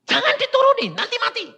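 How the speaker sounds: high, loud panicked cry; panicked grandparents. Retold or imitated. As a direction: beg them not to take him off, high. (teriakan panik nenek, tinggi dan keras)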